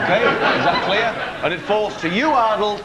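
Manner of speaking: ordinary delivery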